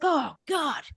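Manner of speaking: high, strained mock exasperation; put-on baffled protest; stressed truck driver. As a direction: groan it like a reluctant client. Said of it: "so stiff and so stressed".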